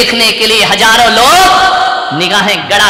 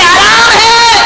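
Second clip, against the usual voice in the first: chirpy parrot; 11 semitones higher, much slower than usual. A narrator's reading. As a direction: squawk it like a parrot. Parrot replies. (ऊँची, तेज़ तोते की आवाज़)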